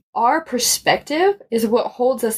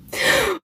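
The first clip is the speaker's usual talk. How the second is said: sharp shocked gasp; a high startled intake of breath; 11 semitones higher than usual. Retold or imitated. Telling a friend about a bad surprise, shocked gasp.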